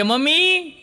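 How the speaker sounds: high call to mother from the kitchen. Retold or imitated. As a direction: call out loud to mummy. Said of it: "કન્યાએ અવાજ કર્યો".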